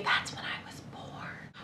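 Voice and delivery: breathy confiding whisper, no voice; whispered aside. Real, not acted. Whispering to the viewers about her birth year.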